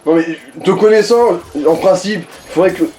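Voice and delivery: ordinary talk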